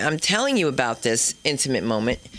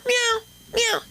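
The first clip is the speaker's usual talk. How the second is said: very high, mock cat meow. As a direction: imitate a cat calling from behind. Cat heard behind her.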